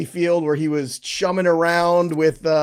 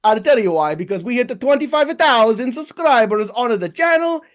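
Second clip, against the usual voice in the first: a cartoon Italian plumber, big and bouncy with an Italian accent; Mario voice; 5 semitones higher than usual. Host as Mario opens the show in a mock Italian accent. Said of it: "speak in a very stereotypical voice just for the opening about an Italian plumber".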